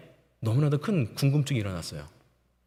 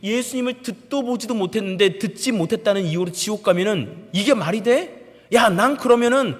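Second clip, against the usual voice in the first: high, heated protest building to loud; indignant senior soldier's mounting tirade; angry outburst; 5 semitones higher, 4 dB louder than usual. Retold or imitated. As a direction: argue indignantly, rising to a yell. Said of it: "라고 저한테 화를 내더라고요".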